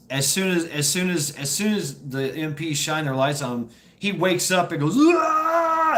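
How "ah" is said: high, loud mock scream; freaked-out scream. Taped-up man waking to flashlights, imitated panicked scream. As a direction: yell as if waking in terror. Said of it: "starts freaking out".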